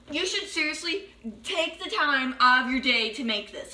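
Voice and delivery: normal talking voice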